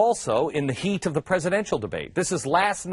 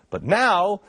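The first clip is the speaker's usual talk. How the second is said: ordinary talk, nothing marked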